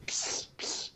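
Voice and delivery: hissed psst psst; unvoiced pssst to get attention; whispered psst. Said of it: "as I was going across".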